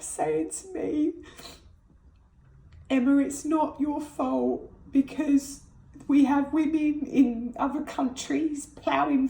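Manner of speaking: high, strained, close to tears; holding it together through real grief; voice breaking. Real, not acted. Mother recalling her baby's death, voice cracking high.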